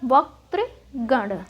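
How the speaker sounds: वक्तृगण said very slowly; correct form. Shown as a demonstration. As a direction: spell out वक्तृगण syllable by syllable. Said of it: "वक्तागण ना होके".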